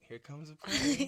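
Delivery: slow, playful spoon-feeding coo; mock parent feeding an infant; baby-feeding voice. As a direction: pretend to feed a baby, drawing it out.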